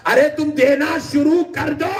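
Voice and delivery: sudden high shout to the crowd; a preacher's rousing shout; calling out. Real, not acted. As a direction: cry it out, urging them to give. Preacher urging the crowd to give.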